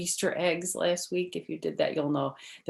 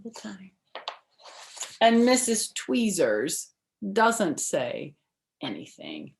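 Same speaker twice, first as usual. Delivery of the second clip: bright, lively storybook read-aloud; reading to children; 4 semitones higher, 5 dB louder than usual. Acted. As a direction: read it like story time for little kids. Reads picture book to children.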